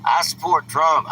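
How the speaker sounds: slow and loud, every word weighted; emphatic declaration. Real, not acted. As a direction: plant each word firmly for the trolls. Firm declaration to hostile commenters.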